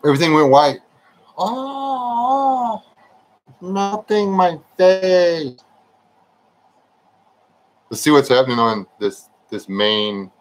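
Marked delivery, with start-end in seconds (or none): 1.4-5.6 mock anguish.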